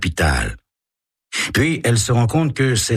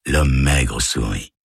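ordinary delivery, nothing marked

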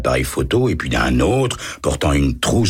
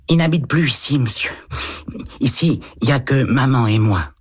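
put-on higher boyish voice, a touch hesitant; little boy voice; 9 semitones higher than usual. A narrator's reading. Boy answering the lieutenant, higher child voice. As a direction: read it as a timid eight-year-old.

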